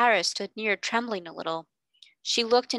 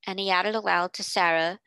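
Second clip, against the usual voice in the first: ordinary delivery, nothing marked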